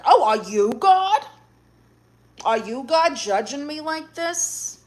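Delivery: slow, put-on incredulous questioning; mock indignation. Streamer challenges people who judge her, mock indignation.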